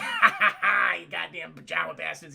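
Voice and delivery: gleeful villain laugh; evil laugh. Retold or imitated. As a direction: cackle at the burning Jawas. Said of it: "He's laughing".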